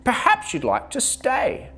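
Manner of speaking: high bright sheep; chirpy sheep invites bear; cheerful sheep voice. A narrator's reading. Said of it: "said Sheep".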